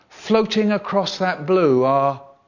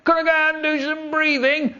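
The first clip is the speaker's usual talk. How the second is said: put-on whiny kid; 12 semitones higher than usual. Whiny kid asking teacher to go out, whining. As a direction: plead in a high whiny child's voice. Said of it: "The whiny ones".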